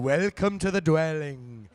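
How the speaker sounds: slow lilting fake Welsh accent; drawn-out sing-song Welsh accent; bad Welsh accent. Shown as a demonstration. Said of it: "we were all talking like this".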